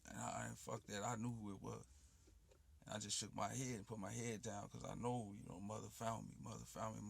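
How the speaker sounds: ordinary talk, nothing marked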